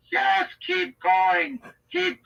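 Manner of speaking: high, drawn-out cheerleading; Leslie Jennings. Acted. Admirer egging the inmate on, gushing. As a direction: urge him on, slow and squealing. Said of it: "doing that Leslie Jennings voice".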